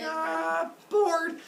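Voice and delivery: put-on high-pitched baby complaint; bored baby. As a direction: whine it like a bored toddler.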